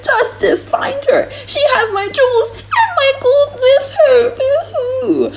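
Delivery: high, loud hammed-up wailing with laughter breaking through; mock frantic wailing. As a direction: mimic a frantic old man wailing over his losses, laughing.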